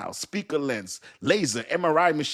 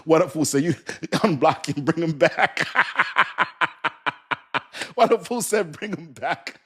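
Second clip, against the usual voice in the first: higher, louder words breaking into laughter; cracking up; 3 semitones higher, 4 dB louder than usual. Real, not acted. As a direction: read it out through real helpless laughter. Cracking up reading a comment.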